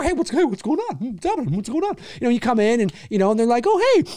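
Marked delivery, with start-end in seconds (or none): 0.0-2.0 excited dog voice. Retold or imitated. 3.7-4.0 mock high greeting.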